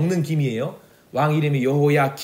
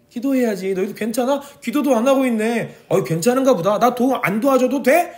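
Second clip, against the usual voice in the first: higher, mock-casual nudging; God teasing people who won't pray; teasing God's voice; 6 semitones higher than usual. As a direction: prod them gently to pray, pitched up. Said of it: "하박국 선지자를 통해서 우리에게".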